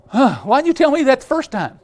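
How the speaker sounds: high, loud chick complaint; indignant eaglet. Acted. Eaglet grumbling at dad for late advice, high and loud. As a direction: squeak out the grievance.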